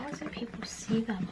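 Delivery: soft, absorbed murmur; hushed question over the cards; murmured to self. Real, not acted. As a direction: ask it under your breath while concentrating.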